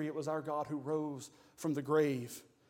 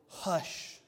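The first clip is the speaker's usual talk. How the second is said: whispered hush. Retold or imitated. God silencing the raging world.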